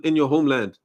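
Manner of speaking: usual speaking voice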